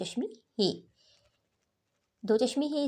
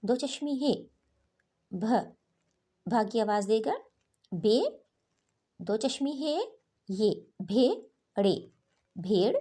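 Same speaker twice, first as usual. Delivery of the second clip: phonics drill. Shown as a demonstration. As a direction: sound out each letter, then blend the word. Spells out letters for students.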